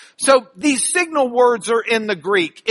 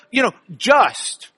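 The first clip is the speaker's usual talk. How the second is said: ordinary delivery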